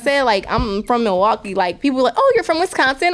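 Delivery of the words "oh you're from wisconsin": higher, mock surprised question; a stranger perking up in surprise; surprised asker. Strangers hear she is from Wisconsin, mock surprised, higher. People asking where she's from.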